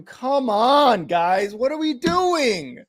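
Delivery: high, pleading exasperation; exasperated. Real, not acted. Fan groaning at his team after an overthrow. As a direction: come on, what are we doing.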